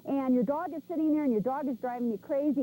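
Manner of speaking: usual speaking voice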